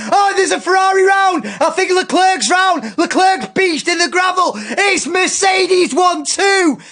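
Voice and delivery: loud, high, excited race call; motorsport commentator. Acted. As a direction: shout it like a live race commentator. Shouted live race call.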